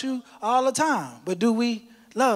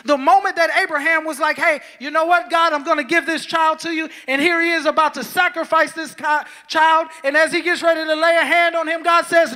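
raised, driving sermon voice; full-force preaching; 7 semitones higher, 6 dB louder than usual. Acted. Pastor preaching to the church in full force. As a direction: preach it out to the whole congregation.

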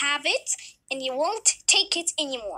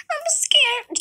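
put-on high, whimpering child; scared little girl; 12 semitones higher, 5 dB louder than usual. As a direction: sound small and frightened. Kayla frightened.

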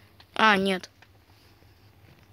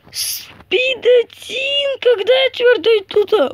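squeaky voice; 11 semitones higher, 5 dB louder than usual. Pretend. Reading speed in game.

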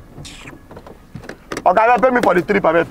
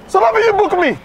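high-pitched, indignant question; incredulous outrage; 10 semitones higher than usual. Acted. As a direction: challenge the passenger in disbelief. Driver outraged at unpaid trip.

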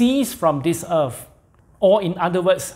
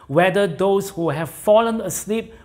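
usual speaking voice

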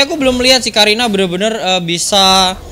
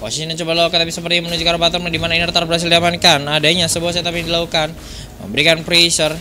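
esports play-by-play; 3 semitones lower, 3 dB quieter than usual. Acted. Live esports play-by-play casting.

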